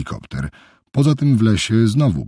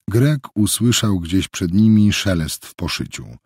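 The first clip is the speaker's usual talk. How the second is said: usual speaking voice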